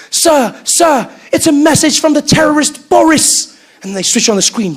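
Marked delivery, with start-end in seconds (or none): 0.1-3.6 loud, higher urgent announcement; panicked assistant. Retold or imitated. Assistant rushing in to tell the UN, urgent and loud. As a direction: burst in with alarming news. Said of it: "The assistant runs in".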